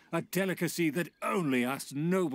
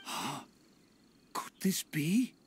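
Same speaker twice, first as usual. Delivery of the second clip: breathless, slow whisper of disbelief; stunned whisper at the sight of the dish; awed whisper; slower than usual. Acted. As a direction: hush it out as if you can't believe your eyes. Noble stunned by the gift.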